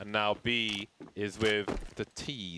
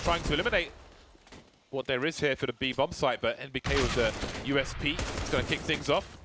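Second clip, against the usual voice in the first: higher, lively play-by-play; esports caster; 3 semitones higher than usual. Acted. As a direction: call the round live. Live play-by-play casting.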